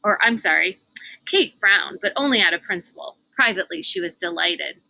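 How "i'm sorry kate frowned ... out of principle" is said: ordinary delivery, nothing marked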